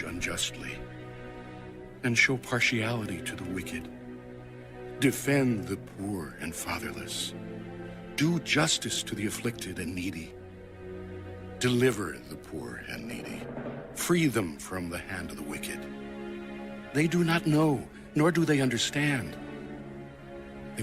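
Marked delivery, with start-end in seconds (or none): none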